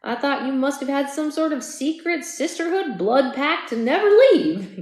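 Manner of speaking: playful husband ribbing his wife; light teasing. Acted. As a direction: tease her gently about the pact. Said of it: "he teased lightly".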